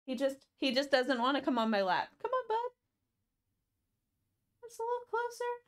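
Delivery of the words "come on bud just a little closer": high, soft coaxing to a shy cat; cooing pet talk. Real, not acted. Streamer coaxing the cat Harvey onto their lap, high cooing pet talk. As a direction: coax the cat closer in a much higher voice.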